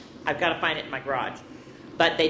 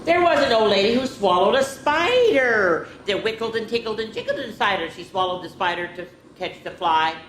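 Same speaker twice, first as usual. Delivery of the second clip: lively storybook read-aloud; reading to children; 5 semitones higher, 6 dB louder than usual. Acted. Teacher reading a picture book to children, lively. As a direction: read it out to the kids with bounce.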